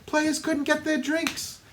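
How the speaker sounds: a hammed-up Mo Green. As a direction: brag like a Vegas tough guy. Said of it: "what does he say when he's ripping on his brother".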